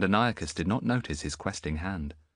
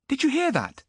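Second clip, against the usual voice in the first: put-on much higher, louder boy's voice; young boy; 4 dB louder than usual. Boy to Antiochus, high bewildered voice. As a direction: sound lost and bewildered.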